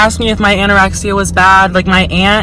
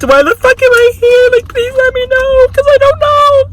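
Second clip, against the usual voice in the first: squeaky high voice. Retold or imitated. To the nurses, asking why she's there, in a squeaky high voice. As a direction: push the pitch way up into a silly squeal.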